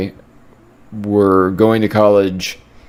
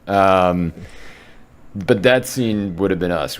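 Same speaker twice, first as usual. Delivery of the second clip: ordinary talk, nothing marked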